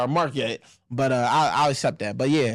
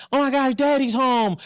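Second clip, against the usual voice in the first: put-on slow, higher-pitched excited kid greeting; drawn-out, high mock-child delight; 6 semitones higher than usual. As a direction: imitate kids thrilled at dad coming home. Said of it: "and the kids is like".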